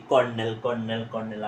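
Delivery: "কর্নেল" as kor-nel, o vowel; common mispronunciation. Shown as a demonstration. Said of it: "সব সময় তোমরা যেটাকে বলে আসো".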